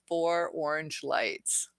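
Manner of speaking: Four orange lights read slowly and puzzled, each word drawn out. Real, not acted. Reading a chat joke aloud, slow and puzzled. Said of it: "You're gonna mess with me".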